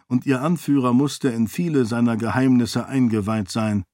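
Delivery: usual speaking voice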